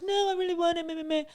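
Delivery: put-on high, soft whiny child voice; mean mimicry of a pleading kid, ending in mocking babble; mock a whining little girl; mocking imitation of daughter. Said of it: "I even, like, imitate her".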